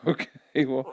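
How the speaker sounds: ordinary talk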